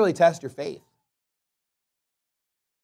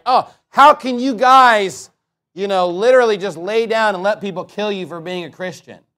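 mock indignant critic; 2 semitones higher, 7 dB louder than usual. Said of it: "They're like".

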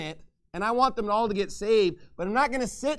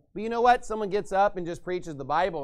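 ordinary talk, nothing marked

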